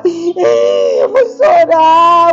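high, drawn-out mock wailing; fake crying. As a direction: pretend to bawl, hammed-up, for a pet. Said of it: "É brincando que tá chorando". (choro fingido, exagerado)

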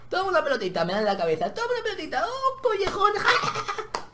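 put-on high taunting kid voice with a cackle; mocking bully. Teasing bully laughs at the victim, high and mocking. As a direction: sneer like the kid pelting you.

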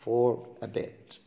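"For a bit" drawn out slowly; slow careful. Shown as a demonstration. Teacher spelling out "For a bit" slowly for students. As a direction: stretch "For a bit" word by word. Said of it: "بسرعة كده أقول لك for a bit".

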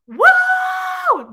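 loud, very high crowd "woo"; piercing mock-frenzied whoop; screamed whoop. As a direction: imitate a crowd screaming to drown out crying. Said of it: "people are screaming".

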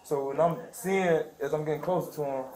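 ordinary delivery

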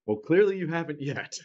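usual speaking voice

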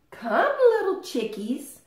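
high, bright calling hen voice; Mother Hen calling. A narrator's reading. Mother Hen calling her chicks to nap, high call. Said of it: "calls Mother Hen".

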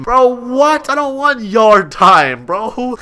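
high, loud, drawn-out objection; whiny protest. Pretend. Inmate told by cop it is yard time, whiny protest. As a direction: whine loudly in disbelief.

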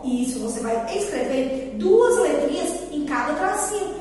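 usual speaking voice

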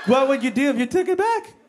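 high-pitched polite probing; mock-polite discomfort. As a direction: imitate an over-nice, uneasy questioner. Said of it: "too nice to tell me".

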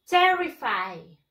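TERrified with a strong, drawn-out TER; slow model of "terrified", force on TER; stress on first syllable. Shown as a demonstration. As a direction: hit TER, then fall away on "rified". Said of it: "la mayor fuerza de voz".

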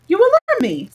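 high, loud cry of alarm; alarmed king. A narrator's reading. King to the grimacing messenger, alarmed. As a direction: sound startled, pitch jumping up.

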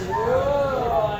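usual speaking voice

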